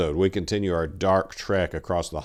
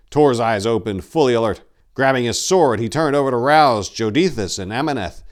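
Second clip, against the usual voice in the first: louder, driving action storytelling; urgent narration; 4 semitones higher, 7 dB louder than usual. A narrator's reading. Narrator building the hellhound attack, urgent narration. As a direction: push the pace of the battle scene.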